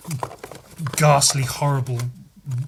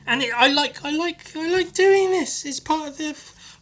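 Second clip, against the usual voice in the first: much higher, squeaky silly voice; mock squeaky voice; 13 semitones higher than usual. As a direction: squeak it like a cartoon. Sudden squeaky self-mockery.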